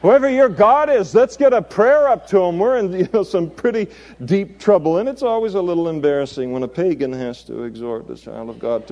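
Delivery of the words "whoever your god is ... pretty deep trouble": high, loud, comic urgency; rally everyone with a raised, pleading voice; urgent ship's captain. Acted. Captain begging Jonah for prayers in the storm, high urgent plea. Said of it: "the captain is in essence saying".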